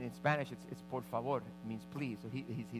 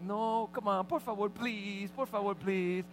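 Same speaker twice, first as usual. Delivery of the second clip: put-on higher, slow whiny little-boy plea; pleading kid; 6 semitones higher, slower than usual. Boy refusing to go ask someone else, slow whiny plea. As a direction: whine it like a small child who won't quit.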